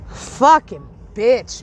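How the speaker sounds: slow, dragged-out contemptuous curse; drawn-out insult. Real, not acted. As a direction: stretch each word out with scorn. Insulting a chatter on live.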